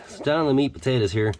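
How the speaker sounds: ordinary delivery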